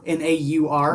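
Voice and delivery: ordinary delivery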